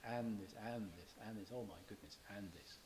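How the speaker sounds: fading, tired "And this, and this"; weary monotony. Shown as a demonstration. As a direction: sigh through the endless list. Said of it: "emphasize the repetitive monotony".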